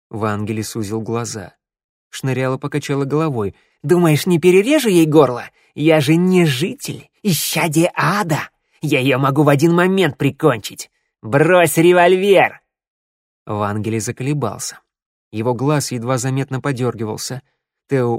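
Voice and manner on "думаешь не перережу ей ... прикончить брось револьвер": menacing shout. A narrator's reading. Shnyryala orders the mayor to disarm, shouting threats. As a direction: bellow it up to a hard command. Hostage-taker threatens, demands gun dropped.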